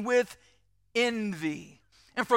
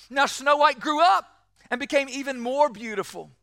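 ordinary delivery

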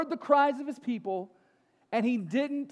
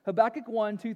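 ordinary talk, nothing marked

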